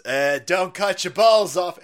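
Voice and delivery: slow, drawled Trump impression; mock Trump. Trump telling lefties, in a slow mock Trump voice. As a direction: spell it out slowly like Trump. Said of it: "Donald Trump goes out there and he goes".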